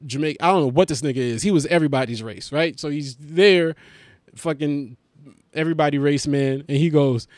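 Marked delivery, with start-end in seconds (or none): none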